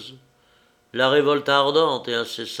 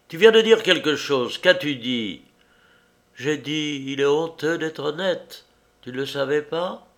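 higher, lightly voiced strange young woman; higher-voiced girl, eerily calm; woman's voice; 5 semitones higher, 4 dB louder than usual. A narrator's reading. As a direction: speak as the odd, intense girl. Woman character speaking.

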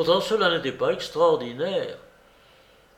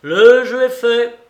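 loud, high announcement; ringing casino call; croupier's cry; 9 semitones higher, 13 dB louder than usual. A narrator's reading. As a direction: belt it out over the table. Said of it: "cria le croupier".

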